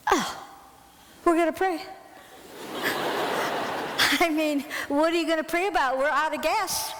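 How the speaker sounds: high, incredulous echo; mocking disbelief. Mocking the boys' prayer plan.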